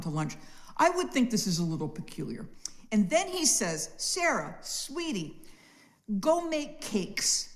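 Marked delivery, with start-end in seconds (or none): 4.0-7.5 high, slow, sugary husband voice asking a favour; voicing Abraham. Abraham calls to Sarah, high and drawn out. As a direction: coax like a husband sweet-talking his wife. Husband calling wife to cook.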